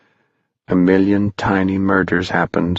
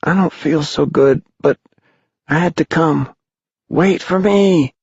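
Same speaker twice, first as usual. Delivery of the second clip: put-on weak boy; 9 semitones higher than usual. Pipkin calling weakly to his friends, high and frail. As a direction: sound ill but keep calling.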